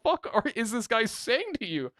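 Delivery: very high, aghast imitation; retold shocked outcry; appalled. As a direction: squeak it out in mock horror. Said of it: "she's appalled".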